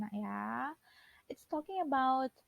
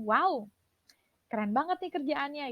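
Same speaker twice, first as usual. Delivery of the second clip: loud, higher thrilled wow; excited praise; 4 semitones higher, 5 dB louder than usual. Shown as a demonstration. Teacher shows students enthusiastic praise. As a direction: gush over the great work. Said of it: "Tapi kalau tambahin".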